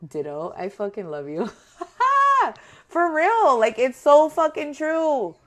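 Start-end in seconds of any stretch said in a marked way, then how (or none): 1.8-2.5 a sudden high laugh; high burst of laughter. Real, not acted. Laughing at the viewer's agreement, high and bright. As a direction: let a delighted laugh burst out.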